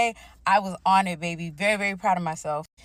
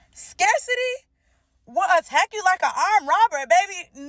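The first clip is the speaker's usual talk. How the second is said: exaggerated outrage; 10 semitones higher, 4 dB louder than usual. Real, not acted. Mock outrage at the verse.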